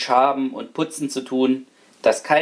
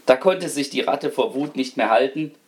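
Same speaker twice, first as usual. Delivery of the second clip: usual speaking voice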